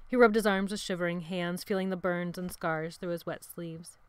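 ordinary delivery, nothing marked

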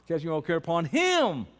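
emphatic, swooping "him"; stress on him. Shown as a demonstration. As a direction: build up and land hard on "him". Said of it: "Say it with emphasis".

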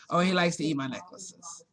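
ordinary talk

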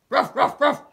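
loud dog barks; loud barking. Acted. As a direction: bark like a dog.

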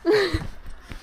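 short soft laugh; breathy laugh. Real, not acted. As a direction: laugh quietly under the breath. Laughing on bumpy ride.

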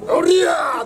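high loud battle yell. Retold or imitated. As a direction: swing and yell heroically. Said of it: "とかやってる". (高く力強い気合いの叫び)

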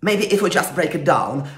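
ordinary delivery

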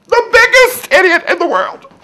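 loud, high, bawling wail; wailing self-pity. Acted. Wife bawling at herself, loud high wail. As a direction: bawl it out as a howl of misery. Repeat, wailed louder and higher.

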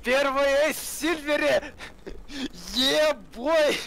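high, elated shout of triumph; excited cheering. Real, not acted. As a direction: cheer the ace like you can't believe it. Teammate cheers his ace.

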